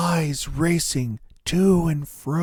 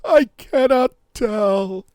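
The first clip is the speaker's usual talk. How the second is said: high, loud, terrified begging; piteous pleading; 12 semitones higher, 7 dB louder than usual. Acted. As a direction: beg for mercy on your knees. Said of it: "he cried in piteous tones".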